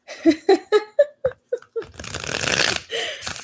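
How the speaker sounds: high, breathless giggle; nervous laugh. Real, not acted. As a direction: giggle from nerves. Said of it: "nerve-wracking knowing that you're watching me".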